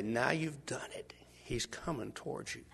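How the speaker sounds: slow, hushed whisper; conspiratorial whisper. Pretend. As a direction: lean in and whisper the warning. Narrator whispering to congregation.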